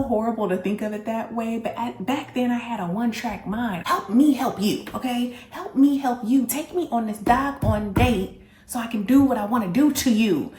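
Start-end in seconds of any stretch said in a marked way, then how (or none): 3.9-10.5 louder and higher, half-joking demand; loud exasperated plea. Retold or imitated. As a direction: push it hard, help me help you. Demanding the date loudly.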